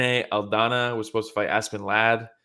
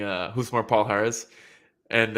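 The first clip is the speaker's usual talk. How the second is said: normal talking voice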